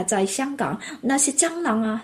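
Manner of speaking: ordinary talk, nothing marked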